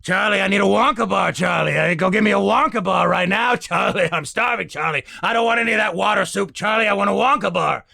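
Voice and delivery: put-on high, loud, demanding old grandpa whining for candy; cranky grandpa begging for a Wonka bar; Grandpa Joe voice. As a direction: nag like a starving, bossy old man. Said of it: "I find my character voice".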